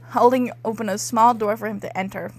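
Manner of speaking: ordinary talk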